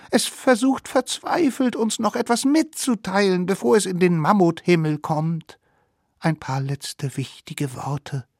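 high voice, sinking gradually; awed little savages. Acted. Little savages awaiting the dying mammoth's last words, awed voice. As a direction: explain it solemnly in a high child-like pitch.

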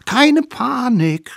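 put-on sly children plotting a trick; high scheming kid voice; little wild kids. Kids calm mammoth.

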